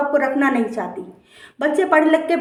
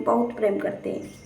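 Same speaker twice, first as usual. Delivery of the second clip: बहुत प्रेम करते हैं said much softer, fading away; fading to a hush; 3 semitones lower, 9 dB quieter than usual. Acted. Preacher to listeners about parents, fading to a hush. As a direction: let it die away, softly pleading.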